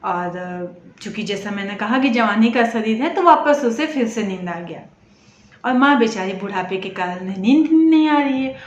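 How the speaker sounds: plain reading voice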